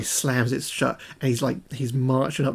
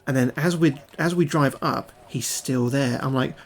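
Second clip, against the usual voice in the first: usual speaking voice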